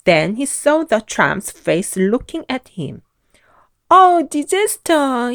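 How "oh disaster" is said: high, loud scream of a timid man; terrified scream. A narrator's reading. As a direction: scream on seeing a giant. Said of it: "he screamed".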